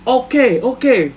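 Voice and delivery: put-on high, drawn-out accented cellmate voice. Cellmate agreeing to a bet, high drawn-out voice. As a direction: stretch it out high and slow. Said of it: "And V be like".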